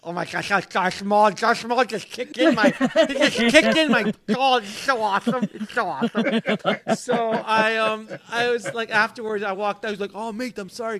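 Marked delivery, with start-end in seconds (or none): 0.0-6.4 high, giddy mock fanboy babble; starstruck gushing. Starstruck fan after Darth Maul kicked in his stall, giddy gushing. 10.2-11.0 higher, softer mock-Australian apology; lightly put-on Australian "mate"; imitated Australian accent. Actor apologizing afterwards to the man, imitated Australian accent. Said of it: "'cause he's Australian".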